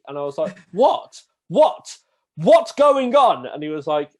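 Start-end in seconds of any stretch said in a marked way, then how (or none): 0.7-3.5 much higher, louder, drawn-out cries; shocked disbelief. Retold or imitated. Learner to his stunned instructor, high loud slow disbelief. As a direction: squeak out the panic slowly, high and loud.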